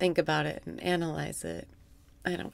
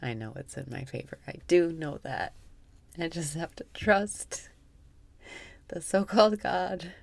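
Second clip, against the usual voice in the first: guided meditation; 3 semitones lower, 5 dB quieter than usual. Acted. Guiding listeners through breathwork in a soft meditation voice. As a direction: guide the breath calmly, half amused.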